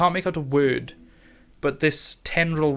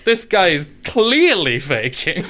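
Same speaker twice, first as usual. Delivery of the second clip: mock aside; 4 semitones higher, 4 dB louder than usual. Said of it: "He's like".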